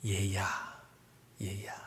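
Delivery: soft, low tender call; gentle calling. Acted. Father to angry son, soft tender call. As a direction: call the child softly and lovingly. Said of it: "부드러운 목소리로 아이들을 부르고".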